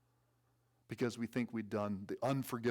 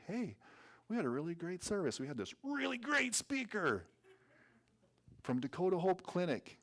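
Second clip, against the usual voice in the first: high, gushing excitement, acted out; enthusiastic invitation; 9 semitones higher, 3 dB louder than usual. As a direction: act out an eager churchgoer raving to a friend. Modeling an enthusiastic church invitation.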